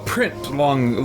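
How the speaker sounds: ordinary talk, nothing marked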